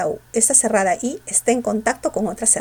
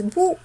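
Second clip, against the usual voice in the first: BÚHO with the U drawn out as the strong vowel; stressed closed vowel; 7 semitones higher than usual. Shown as a demonstration. Teacher showing the class where the force falls, stressed U in búho. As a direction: lean on the U in "búho". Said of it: "se pronuncia con mayor fuerza de voz".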